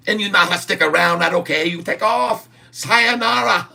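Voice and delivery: high mock Chinese accent rising to a squeak. Streamer tells quitters to leave in a high mock Chinese accent. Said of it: "I can speak a Chinese".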